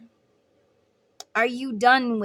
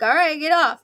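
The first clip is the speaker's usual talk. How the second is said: annoyed carny; 4 semitones higher, 7 dB louder than usual. Retold or imitated. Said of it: "the carny guy's like".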